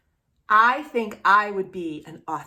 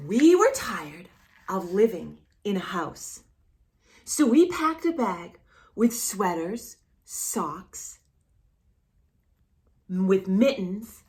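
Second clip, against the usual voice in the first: put-on reading to children. Reading the picture book aloud to a child, gentle.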